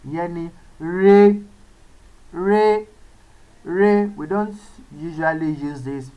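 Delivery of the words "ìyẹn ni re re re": re held level on a steady middle pitch. Shown as a demonstration. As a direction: sing "re" flat and even, mid tone. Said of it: "the mid tone".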